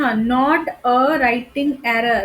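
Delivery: slow, careful stress on not. Shown as a demonstration. Teacher stressing the key word to students, slow and deliberate. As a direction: lean on "not a writing error" slowly. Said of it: "इसमें बताना है कि writing error कौन सा है".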